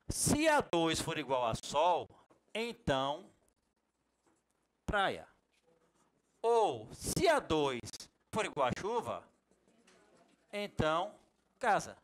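slow, spelled-out reading of the formula. Shown as a demonstration. Teacher reads the IF formula slowly to students. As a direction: read each condition deliberately. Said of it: "Vou ler como eu falo".

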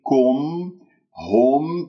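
"كم، هم" as dark "kom, hom"; wrongly heavy endings. Shown as a demonstration. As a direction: open the u to a thick "o". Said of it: "تفخمونها وهذا".

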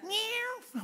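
high, little mewing kitten; mock the church as a scared kitten; mock timid kitten. Preacher mocking a timid church, high kitten mews. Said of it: "the church is going".

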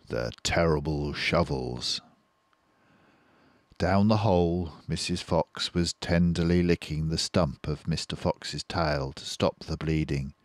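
usual speaking voice

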